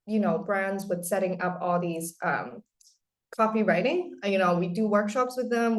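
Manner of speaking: usual speaking voice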